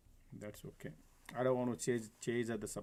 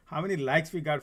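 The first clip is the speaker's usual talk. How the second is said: loud, higher call to the chat; rally the viewers, louder; excited appeal; 5 semitones higher, 11 dB louder than usual. Real, not acted. Trader to stream viewers, loud rallying call.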